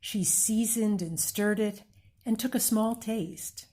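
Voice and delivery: plain reading voice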